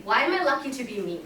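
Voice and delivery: "Why am I lucky to be me?" said fast in one quick breath; quick rapid-fire. Shown as a demonstration. Showing the group how to answer "Why am I lucky to be me?" fast. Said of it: "Just quickly, rapidly".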